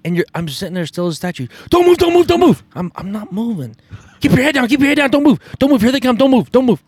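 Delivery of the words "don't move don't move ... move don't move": much higher and louder, urgent orders; hammed-up caller hissing orders then booming; mock frantic hunting caller. Caller ordering the hunters to freeze, hammed-up frantic yell. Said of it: "all the time he's saying".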